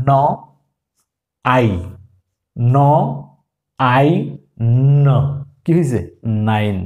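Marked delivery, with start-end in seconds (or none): 0.1-5.1 ন, আই, ন, আই, ন as separate sounds, long pauses; phonetic sounding out. Shown as a demonstration. Teacher matching nine to its phonetic sounds, said separately. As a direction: sound out ন... আই... ন, each alone. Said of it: "phonetic sound অনুসারে মিলাই".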